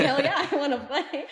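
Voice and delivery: thrilled, gleeful acceptance; excited laughing yes. Retold or imitated. Thrilled and laughing as she tells the coach she wants to play.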